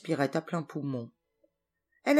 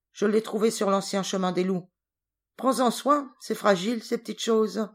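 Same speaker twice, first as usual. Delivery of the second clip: lightly voiced gruff hunter, raised and louder; 6 semitones higher, 4 dB louder than usual. A narrator's reading. Hunter presents the girl to his wife, gruff and mocking. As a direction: boast mockingly about the find. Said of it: "d'un ton bourru".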